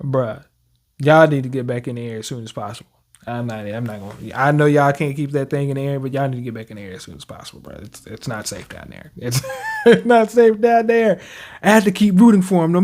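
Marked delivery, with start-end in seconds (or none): 1.0-7.8 fast urging. Real, not acted. Urging characters on screen. 9.8-11.2 laughing warning. Real, not acted. Laughs while saying it.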